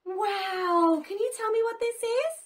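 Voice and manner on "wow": ordinary talk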